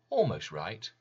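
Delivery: ordinary delivery, nothing marked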